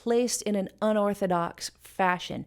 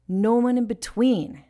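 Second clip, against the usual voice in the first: "No" and "tween" punched louder; stress first and last; 2 semitones higher, 5 dB louder than usual. Shown as a demonstration. Teacher marking natural emphasis, "No" and "tween" stressed. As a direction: hit "No" and the end of "between". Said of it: "No tween".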